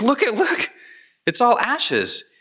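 freaking out. Retold or imitated. Wealthy man panicking as his gold turns to ash, alarmed cry. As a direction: blurt it out in alarm as the gold crumbles.

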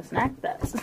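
ordinary talk, nothing marked

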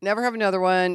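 ordinary delivery

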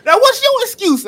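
high, loud imitated taunt; mocking taunt. Mom taunting the lady, high loud imitated yell. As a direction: jab it at her like mom. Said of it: "Then she said".

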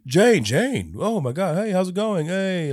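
high, goofy dumbass voice; high-pitched mock dopey greeting; Thor. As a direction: play an overeager lovable idiot greeting an ex. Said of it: "When did Thor become a dumbass".

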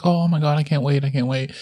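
high, eager mock excitement; excited squeal. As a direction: imitate them squealing with excitement. Excited friends' reaction retold.

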